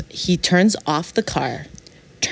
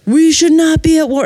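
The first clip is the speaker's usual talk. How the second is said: raised, much higher and louder; retold shouting; 9 semitones higher, 5 dB louder than usual. Date shouting about the war in Iran, retold shouting. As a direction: imitate a man shouting his opinion.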